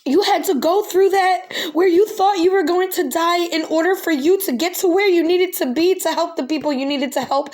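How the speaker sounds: high, strained voice breaking into tears; real tears, voice cracking high and loud; tearful, choking up. Real, not acted. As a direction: push through the words while crying. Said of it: "I'm so sorry. Oh my God".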